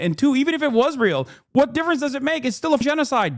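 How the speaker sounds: rising exasperated retort. Real, not acted. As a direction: pitch climbing high in heated disbelief. Heated argument with chat.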